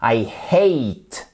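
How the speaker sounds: "hate" with an audible H and gliding ay; sounded H, diphthong. Shown as a demonstration. Teacher showing learners the sounds, "hate" with clear H and diphthong. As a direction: breathe the H in "hate", glide through the ay. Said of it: "vous avez le H comme ceci".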